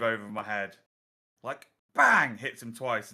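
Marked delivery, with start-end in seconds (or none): 1.9-2.4 shouted sound effect. Acted. Acting out a glaive hit.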